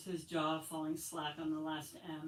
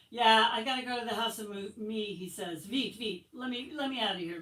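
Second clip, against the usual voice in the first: pleading whine; 4 semitones higher, 7 dB louder than usual. Acted. Boy begging to leave.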